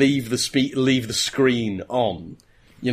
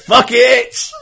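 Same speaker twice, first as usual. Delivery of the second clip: high, loud, laughing outburst; giggling mock panic as he jumps from the car; laughing mock yell; 9 dB louder than usual. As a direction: throw it away with a panicked yelp. Dodgson bailing from the car.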